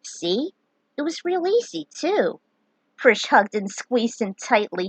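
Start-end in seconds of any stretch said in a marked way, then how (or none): 0.0-2.4 put-on lightly raised boyish voice, pleased; a touch higher, proud of himself; Luneth's voice.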